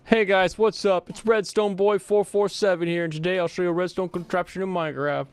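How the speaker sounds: high, loud YouTuber intro voice; Redstone Boy. Acted. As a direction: open the video like a hyped-up Minecraft YouTuber. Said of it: "you have to find Redstone Boy".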